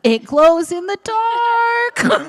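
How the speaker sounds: high, loud, drawn-out squeal of delight; excited shout. Real, not acted. Presenter hyping the glowing prize, shouting drawn out. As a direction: stretch it out high and loud, thrilled.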